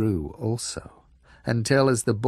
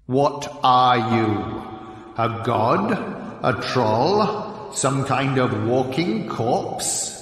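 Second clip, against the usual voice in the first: slow, loud and suspicious giant; a looming giant sizing him up; frost giant voice; 3 dB louder, slower than usual. A narrator's reading.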